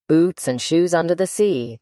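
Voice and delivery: ordinary delivery, nothing marked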